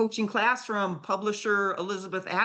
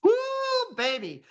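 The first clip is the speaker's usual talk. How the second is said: excited whoop; 13 semitones higher, slower than usual. Real, not acted. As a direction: whoop it out, delighted. Exclaiming after the kiss story.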